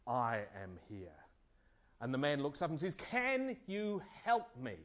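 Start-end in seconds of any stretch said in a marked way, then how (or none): 0.1-1.2 mighty voice. Acted. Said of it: "a mighty voice replies". 3.0-4.8 pleading climber. Acted.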